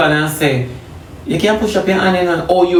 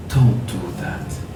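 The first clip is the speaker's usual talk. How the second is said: slow, low and hushed warning; soft inner voice; 10 semitones lower, 6 dB quieter, slower than usual. Acted. Something warns the believer inwardly, hushed and low. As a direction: drop deep and soft, drawing it out. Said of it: "You hear something say".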